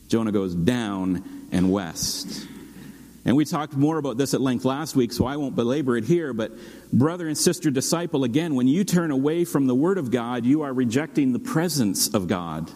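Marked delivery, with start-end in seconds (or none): none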